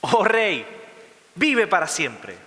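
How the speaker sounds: acted reverent proclamation, drawn out at the end; Daniel's reply. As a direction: call up to the king in solemn salute. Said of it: "le respondió al rey".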